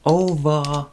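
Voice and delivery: over with a loud Boston accent. Real, not acted. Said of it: "that's my Boston accent coming out".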